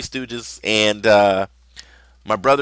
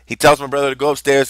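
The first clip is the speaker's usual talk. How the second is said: ordinary delivery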